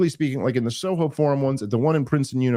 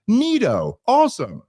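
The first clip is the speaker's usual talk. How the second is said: high-pitched, gullible enthusiasm; naively eager; 10 semitones higher, 3 dB louder than usual. Retold or imitated. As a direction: act out your own wide-eyed, trusting agreement. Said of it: "a naive romantic in my heart of hearts".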